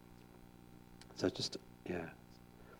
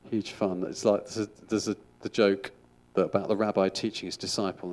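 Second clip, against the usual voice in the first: normal talking voice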